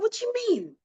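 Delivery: high, put-on indignant question; offended retort. Mimicking her own offended reply to a compliment, high and indignant.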